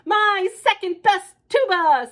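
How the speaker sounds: father wailing about his tuba; anguished cry. Acted. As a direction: cry out over the ruined tuba.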